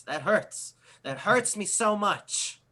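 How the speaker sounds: exaggerated mock wounded bully; mock hurt. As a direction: play wounded, sarcastically. Bully mocks Mark's insult as hurtful.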